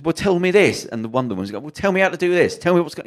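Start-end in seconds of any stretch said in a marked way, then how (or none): 0.0-0.8 high, insistent demand; retold challenge. Him challenging his mates in a pub, insistent and high. 1.5-3.1 high-pitched, pressing argument; retold demand. One of them in the pub demands answers, high and insistent.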